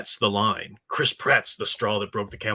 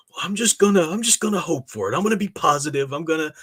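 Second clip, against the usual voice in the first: higher, brighter voice of a hopeful fan; mock naive optimist; 6 semitones higher, 3 dB louder than usual. Streamer parodies fans hoping for the best, in a light mocking voice. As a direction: parody blind positivity, pitched up. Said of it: "you'd be silly just to say".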